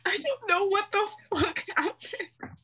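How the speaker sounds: high, laughing disbelief at own story; laughing I don't know what happened. Real, not acted. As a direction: squeal it out, half laughing. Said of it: "Oh my god".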